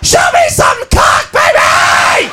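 harsh, drawn-out scream; wild girl at club. Acted. Girl at club screaming at one guy, wild. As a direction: holler it hoarsely at the stripper. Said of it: "She's going".

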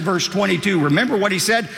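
usual speaking voice

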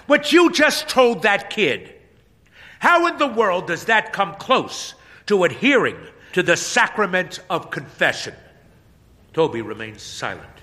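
loud, high righteous scolding; indignant scolding. Acted. Pastor scolding Toby for his advice, loud and high. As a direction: tear into him.

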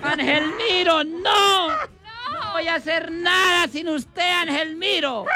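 very high, shouted mock sobbing; overacted widow howling over the coffin; hammed-up wailing. Mother-in-law weeps over her son's coffin, hammed-up sobbing shout. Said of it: "ella lloraba en el acto".

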